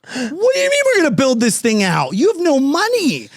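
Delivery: exasperated protest; protesting to his broke friend, high and incredulous; incredulous dismay. Retold or imitated. Dismay at building plans.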